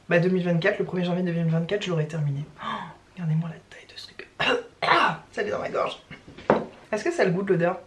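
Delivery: ordinary talk, nothing marked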